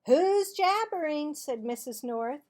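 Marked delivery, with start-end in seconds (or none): 0.1-1.3 high, loud stern teacher call; scolding teacher. Acted. Mrs. North to the class, high scolding call. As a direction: snap at the chatty pair.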